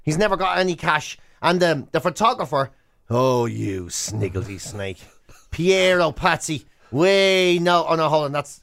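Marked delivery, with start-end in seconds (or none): 3.1-5.0 low, slow, theatrical sneer at a villain; hammed-up low, slow contempt; mock scolding. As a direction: drag it out like a pantomime boo.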